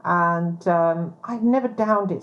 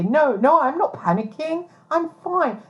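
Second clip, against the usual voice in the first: quoting herself; 9 semitones higher than usual. Retold or imitated. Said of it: "what was I supposed to say".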